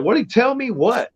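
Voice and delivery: startled demand. Retold or imitated. Father pressing wife and daughter, high startled question. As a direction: jump way up in alarmed curiosity.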